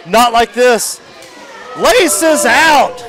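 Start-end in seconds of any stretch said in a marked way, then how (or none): 1.8-3.0 excited call. Real, not acted. Calling a kick.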